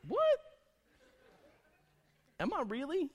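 very high incredulous squeak; mock disbelief. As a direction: react stung, pitch shooting way up. Stung by being called a baby.

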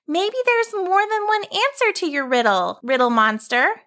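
put-on high girl insisting; girl standing her ground; young girl voice.

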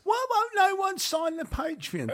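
very high, drawn-out falsetto whine; mock wail. Co-host moaning that nobody signs up, in a high mock wail. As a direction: wail it slowly, far up in pitch. Mock wailing about Patreon sign-ups.

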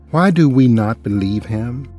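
usual speaking voice

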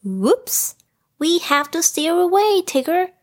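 high little character voice, mildly worried; a touch of anxiety, pitched well up; slightly anxious. A narrator's reading. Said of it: "slightly anxious".

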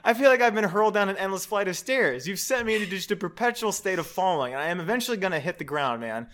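mock-tragic letter read with bursts of laughter; laughing through a reading. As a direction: keep cracking up while reading the sob story. Reads message, laughing through.

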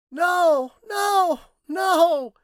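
very high, loud cries of terror; acted terror, each word a piercing cry; terrified cry. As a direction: cry out in sudden panic. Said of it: "exclaimed Carolyn Pierce suddenly, in evident terror".

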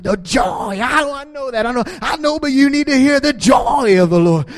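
lightly hammed-up preacher; playful preaching. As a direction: tease her out of her mood with a mini sermon. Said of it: "I just started preaching at her".

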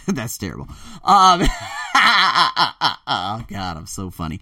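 chuckling through the words; laughing. Real, not acted. After mocking an accent, laughing at himself.

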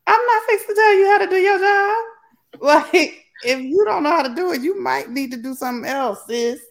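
high-pitched, quick, sassy put-down; Sansa. Retold or imitated. Mistress telling off her new handmaiden, sassy. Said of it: "all the sass that Sansa gave her".

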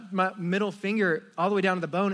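ordinary talk, nothing marked

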